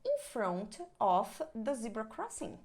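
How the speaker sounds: slow, clear "In front of the zebra crossing"; slow teaching demo; slow model answer. Shown as a demonstration. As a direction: spell out the directions slowly for students. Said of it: "por exemplo".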